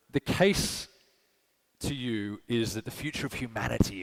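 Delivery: ordinary talk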